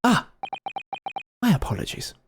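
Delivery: the detective presenting himself; grand self-introduction. Acted. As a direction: announce yourself like the century's greatest.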